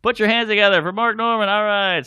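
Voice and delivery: hammed-up host at the podium mic; mock emcee intro. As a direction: introduce the comic to a bored room, pompous.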